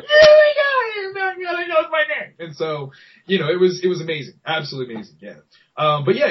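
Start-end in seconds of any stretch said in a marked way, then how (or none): 0.0-2.3 high squealing shout; squealing with excitement. Retold or imitated. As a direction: squeal it like a thrilled little pig. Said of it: "I was a squealing little pig that day".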